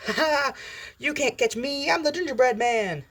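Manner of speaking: gleeful, very high sing-song taunt; Deadpool. Acted. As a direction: squeal a childish taunt while running away. Acted Deadpool taunts while fleeing.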